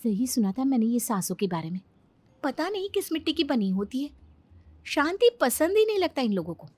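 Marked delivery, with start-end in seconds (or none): none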